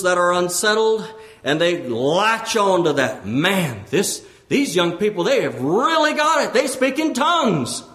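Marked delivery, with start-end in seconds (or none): 3.3-7.8 impressed onlooker. Acted. Voices impressed onlookers at church.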